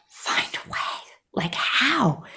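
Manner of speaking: whispered, bewildered disbelief; retold thought in a hushed whisper; incredulous whisper. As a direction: whisper it like a private, helpless question. Retold despairing thought at coach's order.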